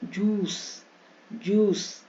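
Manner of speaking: जूस with a held "joooos"; long oo vowel. Shown as a demonstration. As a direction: draw out the oo in each जूस. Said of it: "यू ई का बड़ी".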